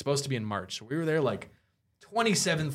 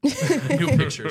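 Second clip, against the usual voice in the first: laughing through it, higher, louder, slow; laughing punchline; 12 semitones higher, 7 dB louder, much slower than usual. Real, not acted. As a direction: let the punchline dissolve into laughter.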